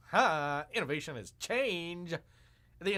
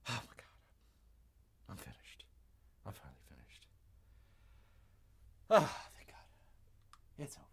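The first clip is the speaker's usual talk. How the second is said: exhausted whisper; 11 semitones lower, 9 dB quieter than usual. Real, not acted. Streamer relieved the clip finally ended, whispered. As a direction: breathe it out in relief.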